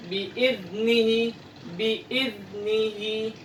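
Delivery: "Biidnihi" slow, tongue between the teeth; voiced th in the middle; Arabic dhal pronunciation; Arabic zal. Shown as a demonstration. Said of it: "Jadi jangan seperti huruf sa ya".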